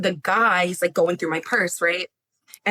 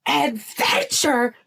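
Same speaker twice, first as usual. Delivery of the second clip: incredulous scoff; 8 semitones higher, slower than usual. Retold or imitated. Scoffing at husband's word.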